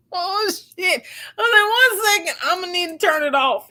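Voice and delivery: high, half-laughing "oh shit"; laughing panic. Real, not acted. Caught between two partners on the live, laughing panic. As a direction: laugh through the panic, rushed. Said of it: "And I was like".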